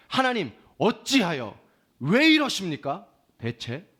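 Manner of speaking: loud, drawn-out challenge to God; defiant prayer. Acted. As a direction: slowly demand an answer from God, loudly. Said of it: "너무 대드는 기도죠".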